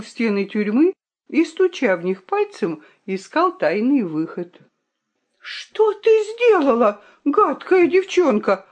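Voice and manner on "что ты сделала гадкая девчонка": high, sharp scolding. Acted. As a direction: let the fox lash out at the girl. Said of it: "обрушилась лиса на Виолинку".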